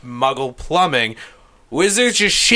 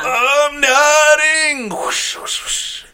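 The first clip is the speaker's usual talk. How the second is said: hammed-up wizard shout; 11 semitones higher, much slower than usual. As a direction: holler it like a silly wizard, then breathe the whooshes. Wizard announcing, then magic whooshes.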